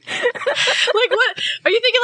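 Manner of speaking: high-pitched, laughing disbelief; incredulous question through real laughter. Real, not acted. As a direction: ask it while really cracking up. Cracking up at co-host's joke.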